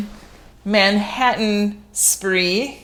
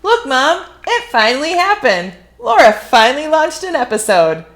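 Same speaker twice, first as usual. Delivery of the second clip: excited calling out; 7 semitones higher, 4 dB louder than usual. Real, not acted. Calling out to mom.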